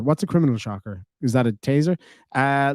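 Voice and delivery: ordinary delivery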